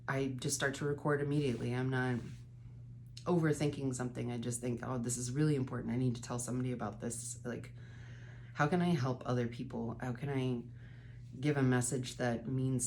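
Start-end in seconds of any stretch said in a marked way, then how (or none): none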